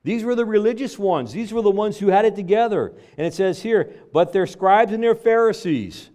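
high, loud pulpit build about the Pharisees; fiery preaching. Acted. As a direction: preach it out hard to the congregation. Preaching rises to full force.

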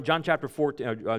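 ordinary talk

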